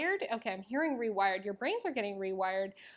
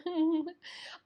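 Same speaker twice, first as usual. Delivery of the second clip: high giggle; 8 semitones higher, 3 dB louder than usual. Real, not acted. Emotional outburst laughing.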